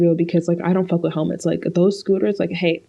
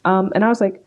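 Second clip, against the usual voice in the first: ordinary delivery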